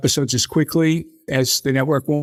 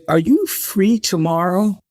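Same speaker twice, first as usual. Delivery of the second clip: slow sly question; 5 semitones higher, slower than usual. Retold or imitated. Asking Rona at night.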